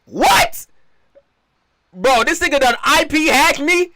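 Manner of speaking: high, loud startled disbelief; jolted by a jump scare; scared horror game streamer. Real, not acted.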